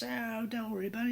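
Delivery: put-on high, breezy Australian accent; Australian fellow. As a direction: imitate an Aussie brushing a worry aside. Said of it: "the Australian fellow".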